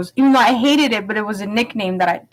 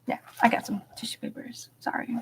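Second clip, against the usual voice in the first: softer, whispered apology; whispered aside; 2 semitones higher, 12 dB quieter, faster than usual. Real, not acted. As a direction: whisper it off-mic. Aside while reaching for tissues.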